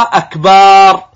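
"أكبار" with a long, loud held "baaar"; stretched baa. Shown as a demonstration. Teacher showing the mistake, "أكبار" with overlong final syllable. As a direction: drag out the aa after the b in "أكبار". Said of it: "فيمد الباء وكأنه ينطقها".

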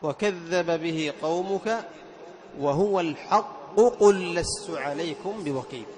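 slow recitation, every qaf of الحق قل sounded; measured verse reading with a firm double q; distinct doubled qaf. Shown as a demonstration. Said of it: "وهكذا القاف عند القاف". (تلاوة متأنية تبين القاف عند القاف)

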